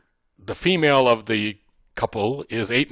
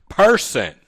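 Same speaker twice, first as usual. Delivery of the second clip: person punched high and loud; stress on person; 8 semitones higher, 6 dB louder than usual. Shown as a demonstration. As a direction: hit person as the key word. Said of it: "the reason I emphasize the word".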